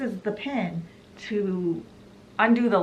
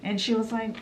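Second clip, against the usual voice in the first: normal talking voice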